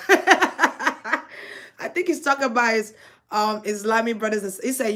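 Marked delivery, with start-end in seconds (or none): 0.1-2.9 chuckling through the words; real amused laugh. Real, not acted. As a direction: laugh as you speak, amused. Laughs at spirit's joke.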